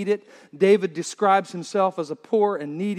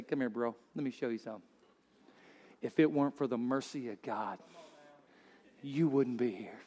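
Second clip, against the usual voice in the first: the Lord, tender; 8 semitones lower, 12 dB quieter than usual. Acted. The Lord greeting a sinner.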